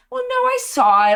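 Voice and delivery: husband's reply. Retold or imitated. Husband voiced in story.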